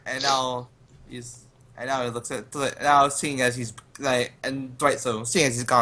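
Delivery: ordinary talk, nothing marked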